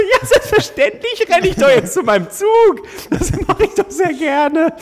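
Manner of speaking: high, loud, syrupy mock politeness; over-the-top fake cheerful compliance; sarcastic eager reply. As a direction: gush it back with exaggerated sarcastic eagerness.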